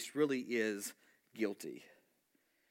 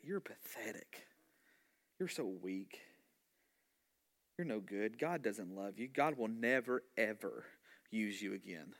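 soft, hushed devil's sneer; accusing hush; 3 dB quieter than usual. Retold or imitated. Preacher voicing the devil accusing the sinner, hushed and cold. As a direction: whisper the accusation like a cruel voice.

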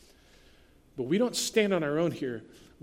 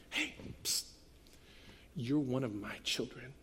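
whisper; 6 semitones lower, 14 dB quieter than usual. Acted. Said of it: "And you know what he whispers?".

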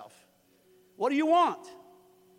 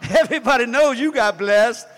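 loud, exultant preacher shout; full-force preaching; 5 semitones higher, 12 dB louder than usual. Real, not acted. Preacher celebrating a member's blessing, full-force shouting. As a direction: shout it in celebration, stretching the big words.

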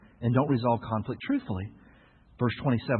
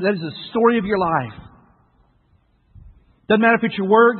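raised, urgent, emphatic preacher delivery; full-force preaching; 8 semitones higher, 8 dB louder than usual. Real, not acted. Preacher warning what unresolved conflict destroys, urgent and forceful. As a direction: press each point hard on the congregation.

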